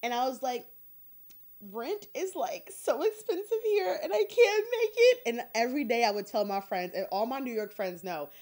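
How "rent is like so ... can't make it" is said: distressed complaint. Retold or imitated. Retold complaint to herself about struggling.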